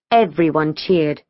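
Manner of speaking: plain reading voice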